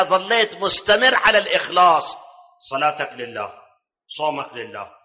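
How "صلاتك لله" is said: ordinary talk, nothing marked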